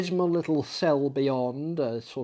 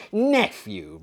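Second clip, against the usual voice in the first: sharp, higher stern address; 11 semitones higher, 5 dB louder than usual. A narrator's reading. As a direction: cut him off with sternness. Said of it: "returned the uncle sternly".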